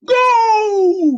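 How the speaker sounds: loud, high roar; roaring coach. A narrator's reading. Coach starting the race, roaring. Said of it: "roared the coach".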